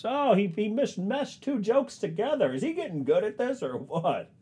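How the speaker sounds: high, far announcer commentary; cheesy announcer about himself; commentator voice. Acted.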